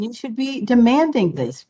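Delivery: usual speaking voice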